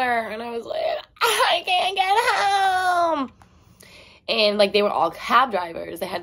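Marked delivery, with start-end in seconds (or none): 1.2-3.3 high, drawn-out mock bawling; hammed-up crying, words stretched out; imitated sobbing wail. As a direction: wail it slowly like a tearful re-enactment. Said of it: "I just started sobbing".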